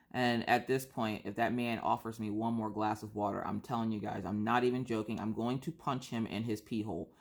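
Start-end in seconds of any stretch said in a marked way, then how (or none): none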